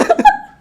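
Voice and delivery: howling laugh at own story; loud laugh. Real, not acted. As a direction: burst of high, loud laughter.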